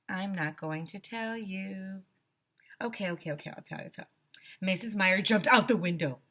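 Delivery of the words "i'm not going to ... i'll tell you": teasing. Shown as a demonstration. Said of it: "teasing listeners then giving in".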